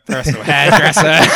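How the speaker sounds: loud, high laughing echo. Real, not acted. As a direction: crack up while repeating the joke. Cracks up echoing the joke.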